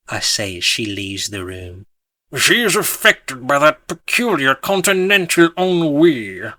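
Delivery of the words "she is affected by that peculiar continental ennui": pompous, intoning observer; pompous gentleman. A narrator's reading. Sir Pardon Smith remarking on Elise, pompous intoning. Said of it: "Sir Pardon Smith observes".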